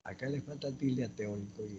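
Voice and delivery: ordinary talk, nothing marked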